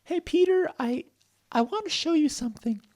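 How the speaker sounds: very high, squeaky invitation; high-pitched shy character voice; hesitant Stella. Acted. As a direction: offer it haltingly in a tiny high voice. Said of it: "Stella said".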